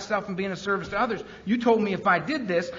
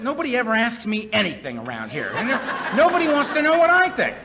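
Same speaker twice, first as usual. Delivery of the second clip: much higher, louder laughing gripe; whiny complaint; 6 semitones higher, 5 dB louder than usual. Retold or imitated. Earl griping to his sponsor, whiny and laughing. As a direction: whine it like a hard-done-by kid. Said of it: "I actually said that to him once".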